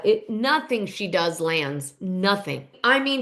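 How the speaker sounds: usual speaking voice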